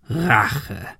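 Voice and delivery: mock the word back, falling; scornful echo from a hoarse villain. A narrator's reading. Gunman laughs at Ben's accusation, mocking. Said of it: "Der Mann lachte Ben aus".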